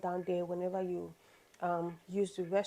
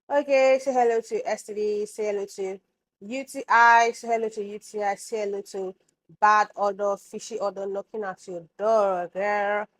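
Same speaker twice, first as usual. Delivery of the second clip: sassy warning; 9 dB louder than usual. Real, not acted.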